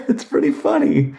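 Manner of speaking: high, giggling through the words; laughing. Real, not acted. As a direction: laugh out "pretty funny". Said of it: "repeats it laughing".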